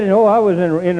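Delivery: ordinary talk, nothing marked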